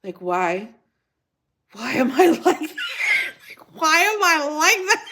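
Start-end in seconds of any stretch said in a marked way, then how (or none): 1.7-5.0 high wail breaking into laughter; exasperated, high-pitched laughing lament; laughing despair. Real, not acted. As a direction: cry out helplessly through laughs. Laughing despair at her own aversion.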